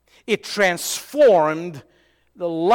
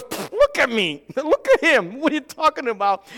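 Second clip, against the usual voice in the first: Sarah scoffing through a laugh, higher and faster; mocking laugh; 6 semitones higher than usual. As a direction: snort it out, half laughing in disbelief. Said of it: "and she's laughing".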